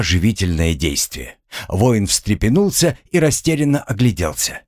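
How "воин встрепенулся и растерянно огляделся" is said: ordinary delivery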